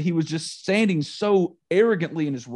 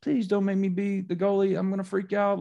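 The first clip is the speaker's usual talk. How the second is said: put-on higher-pitched pleading of a panicking little girl; mild imitated panic; 6 semitones higher than usual. Young goalie pleads with coach, imitated freak-out. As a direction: imitate a kid begging to get out of it. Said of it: "And she's freaking out".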